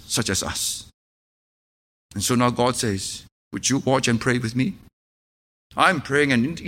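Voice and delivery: low, soft, earnest preaching; intimate, solemn pulpit voice; hushed sermon. Acted. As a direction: draw the congregation in quietly.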